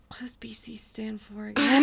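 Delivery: puzzled whisper with a laugh; whispered confusion. Retold or imitated. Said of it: "and I was like".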